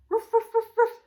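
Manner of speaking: high loud ruff barks; barking dog. Shown as a demonstration. Teacher barking like a dog for children. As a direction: bark like a puppy.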